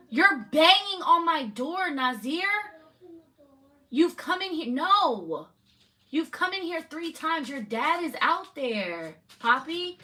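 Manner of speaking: real exasperated telling-off, slowed and pointed; angry scolding. Real, not acted. Mom scolds kids at her door.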